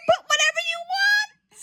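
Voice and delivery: high, loud, over-the-top glee; excited squeal. Acted. Told to name any amount, excited squeal. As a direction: squeal it like hitting the jackpot. Acted quote about blank check.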